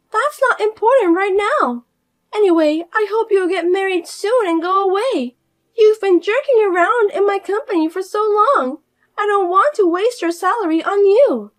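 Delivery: high, loud, preachy; condescending sister-in-law. Acted. Fiona pushing her sister-in-law to marry, high and preachy. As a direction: talk down to her like a child.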